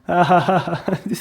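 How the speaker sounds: short scornful laugh; mocking laugh. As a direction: laugh him off. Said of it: "And he's laughing at him".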